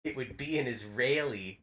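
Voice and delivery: near-silent, very soft delivery; a very soft, murmured aside; hushed punchline. Retold or imitated. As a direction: slip the punchline in almost inaudibly. Leans in to brother.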